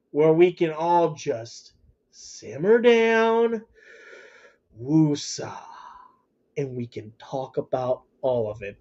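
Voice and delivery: slow, soft, settling exhale; calming down. Real, not acted. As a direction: ease off like taking a breath. Calming down, saying woosah.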